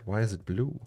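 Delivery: usual speaking voice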